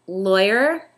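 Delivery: ordinary delivery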